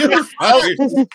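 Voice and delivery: high, loud mimicking squeak; mocking mimic. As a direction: parrot his line back to mock him. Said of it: "You always do, you fuckers".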